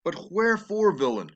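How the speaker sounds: Juliet's lines read with feeling; lightly acted Shakespeare reading; dramatic reading. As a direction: read the verse as a grieving young bride. Reads Juliet's lines aloud.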